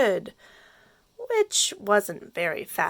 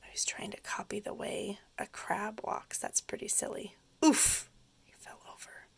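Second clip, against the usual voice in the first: soft whispered storyteller aside; 10 dB quieter, faster than usual. A narrator's reading. Reader whispering asides to young listeners, hushed. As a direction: lean in and whisper to the children.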